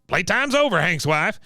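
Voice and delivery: high-pitched bossy veteran impression; mock old soldier cutting in; Cotton. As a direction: announce it as a cranky old man, pitched well up. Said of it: "Cotton comes in and goes".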